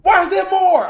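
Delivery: a disbelieving shout as the clip keeps going; shocked outburst. Real, not acted.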